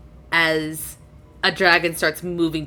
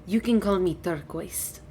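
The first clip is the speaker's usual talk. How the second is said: lightly voiced gracious dragon; 8 dB quieter than usual. Dragon introduces herself to Gadri, mildly gracious. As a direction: introduce yourself as a courteous dragon, understated. Dragon gives its adopted name.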